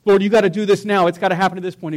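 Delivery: loud, pushy demand aimed at God; demanding prayer. Retold or imitated. An impatient believer making loud demands of God. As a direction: insist God act right now, impatient. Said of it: "I'm not sitting there going".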